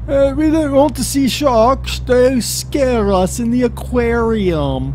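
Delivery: high, slow mock-British voice; weird British accent. Mocking shark-free aquarium visitors, high weird British accent. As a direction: drawl it in an odd, half-British accent. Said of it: "that weird British accent came from".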